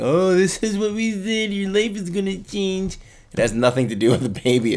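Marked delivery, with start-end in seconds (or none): 0.0-3.0 high, sing-song imitation of unwanted parenting advice; a mocking higher voice for advice from other parents; mock know-it-all parent. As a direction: parrot a smug parent's wisdom. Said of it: "I don't wanna hear anybody saying".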